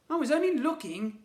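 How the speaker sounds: put-on high, loud childlike protest; protesting boy. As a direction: plead your innocence as a small boy. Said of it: "Ned would protest".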